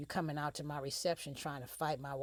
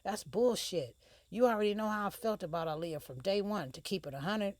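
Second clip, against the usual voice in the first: higher and louder, heated retort; angry argument; 7 semitones higher, 6 dB louder than usual. Acted. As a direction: snap back at her, fed up.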